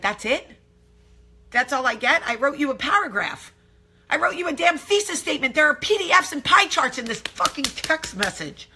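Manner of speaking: exasperated comic rant, building; indignant outburst. Retold or imitated. Wife outraged by husband's short text, indignant and building. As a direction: climb from disbelief to high-pitched outrage.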